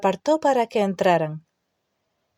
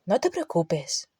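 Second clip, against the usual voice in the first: reassuring; 5 dB quieter than usual. A narrator's reading. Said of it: "la tranquilizó Harry".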